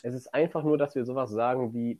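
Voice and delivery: ordinary delivery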